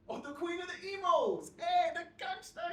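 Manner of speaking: high, mocking cheer; mocking jeer. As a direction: jeer as if hailing a star. Lad mocks the narrator.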